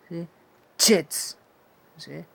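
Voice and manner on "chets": "Chets" with t and ch fused at the start; raised, loud model word "Chets"; T-plus-CH demo. Shown as a demonstration. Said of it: "una t combinada con una ch".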